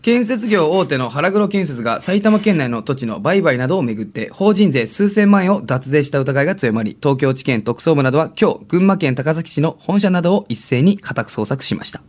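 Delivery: news anchor read without a breath; all in one breath. Acted. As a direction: read it like a newscaster, no pauses. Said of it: "息継ぎなしのニュースキャスター原稿".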